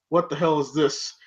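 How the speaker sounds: Paulie voice. Retold or imitated. Voicing Paulie.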